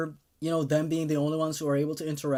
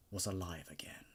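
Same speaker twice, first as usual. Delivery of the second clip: whisper; 5 semitones lower, 12 dB quieter than usual. Acted. Escaped captive, quiet awe.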